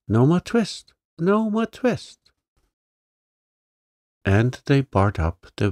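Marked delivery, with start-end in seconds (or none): 0.1-2.2 high squeaky shouts, higher on the second; twittering mouse shout. A narrator's reading. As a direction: shout like tiny jeering mice. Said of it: "shout at once in little twittering voices".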